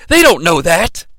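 grave, deadpan assurance; the Cat seriously reassuring Glenn about the plastic sword. Retold or imitated. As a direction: say it dead straight, like a secret. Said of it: "very seriously".

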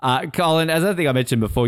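ordinary delivery

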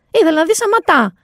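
ordinary talk

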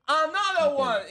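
drawn-out announcer call, in English; imitating announcer. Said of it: "Y dice". (imitando al locutor, lento y más agudo)

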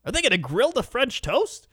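put-on questioning the menu like an anxious old woman; hammed-up fretting elderly wife; fussy old woman. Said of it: "she was like".

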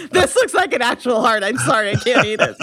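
laughing through the words; giggling while speaking. Retold or imitated. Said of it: "He started laughing".

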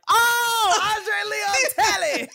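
high, loud, slow cry of recognition; hammed-up squeal of recognition; excited shout. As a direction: shout his name like a delighted fan. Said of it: "I was like".

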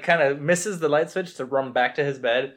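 usual speaking voice

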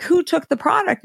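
retold anxious demand to the Whole Foods guy; urgent demand. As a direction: press the store guy for who took it. Demanding answer on phone.

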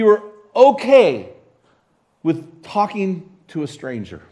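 preaching at full force. Acted. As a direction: drive the point home from the pulpit.

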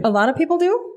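genuinely taken aback; surprised question. Real, not acted. Hearing people love interruptions.

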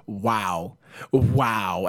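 low, cold imitation of an unimpressed mother; two heavy, sarcastic wows; disdainful mom. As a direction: imitate a drunk mom turning on you. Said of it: "turns the gun on me".

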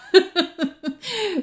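high amused laugh. Real, not acted. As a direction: laugh at the unfair loss. Laughs about losing the contest. (risa aguda y divertida)

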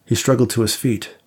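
ordinary delivery, nothing marked

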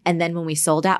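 normal talking voice

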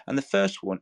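ordinary talk

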